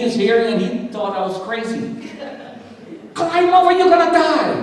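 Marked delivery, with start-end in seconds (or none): 3.1-4.6 high-pitched warning cry; sudden high urgent shout, retold; urgent calling out. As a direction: imitate a frantic man yelling through a wall. Man calls through the wall.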